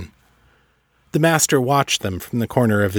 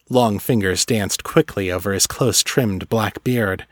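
ordinary delivery

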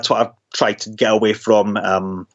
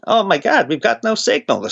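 higher-pitched mock American accent; 9 semitones higher than usual. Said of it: "this is my American accent again".

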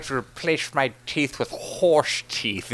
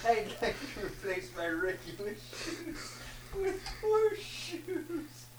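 laughing; 7 semitones higher, 14 dB quieter, slower than usual. Real, not acted. Repeats partner's horse line.

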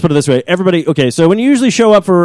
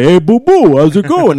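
lightly voiced Yogi Bear greeting; a hint of the cartoon bear saying "Hey, boo-boo"; 3 semitones higher, 3 dB louder, slower than usual. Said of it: "you just do a Yogi Bear".

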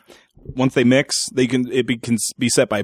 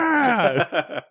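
higher gleeful cheer; mock cheer; 8 semitones higher than usual. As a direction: cheer like the crew. Crew cheering.

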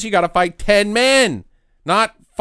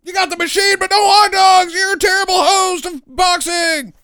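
mock outrage; 9 semitones higher, 5 dB louder than usual. Mock scolding of the movie's host.

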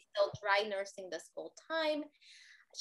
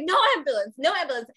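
shouting; 3 semitones higher, 8 dB louder, much faster than usual. Retold or imitated. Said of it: "screaming at each other".

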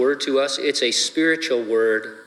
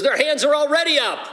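Sundance, much higher; 13 semitones higher than usual. Retold or imitated. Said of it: "Sundance goes".

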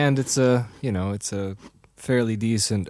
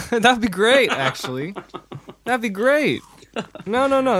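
high, laughing delight; 10 semitones higher than usual. Real, not acted. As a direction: giggle through the words. Laughing at missing teeth idea.

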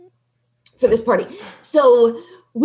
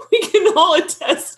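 ordinary talk

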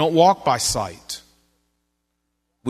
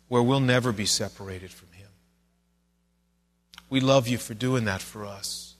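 earnest, measured pastor's prayer; closing prayer. Acted. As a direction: pray it humbly for the congregation.

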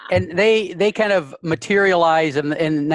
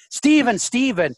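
screaming name; 6 semitones higher than usual. Shown as a demonstration. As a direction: cry out Steven across the street. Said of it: "she's screaming Steven".

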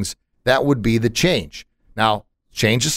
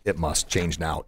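soft, low restating of the rule; quietly repeating the first belief; quiet restatement; 6 semitones lower, 9 dB quieter than usual. Shown as a demonstration. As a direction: say it softly, like a correction. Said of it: "the first one was".

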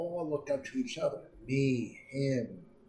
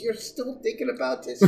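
high amused teasing voice, pitched up; imitating a teasing friend; 12 semitones higher than usual. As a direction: needle him about still caring. Patrice laughing at his grudge.

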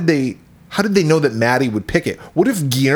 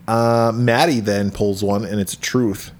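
ordinary talk, nothing marked

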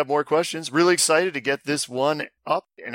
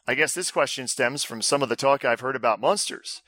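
normal talking voice

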